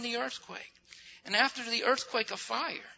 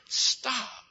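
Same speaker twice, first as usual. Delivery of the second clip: ordinary delivery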